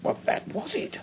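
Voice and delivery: whispered grimly; hushed whisper. Acted. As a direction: breathe it out in a whisper. Grim reveal of blood word.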